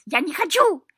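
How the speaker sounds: very high, loud protest; shouting. A narrator's reading. Cat protesting about going to court, shouting. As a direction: scream it out in refusal. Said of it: "закричал кот".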